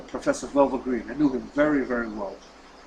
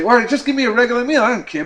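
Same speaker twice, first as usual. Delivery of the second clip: fast, much higher and louder; exasperated give-up; 9 semitones higher, 10 dB louder, faster than usual. Retold or imitated. Professor nearly caves on the plane, fast exasperated blurt. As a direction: throw up your hands and blurt it.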